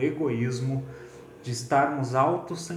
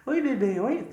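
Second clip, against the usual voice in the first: calling a dog; 10 semitones higher than usual. Retold or imitated. Preacher jokes he greets the baby like calling a dog. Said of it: "Pareceu chamando um cachorro".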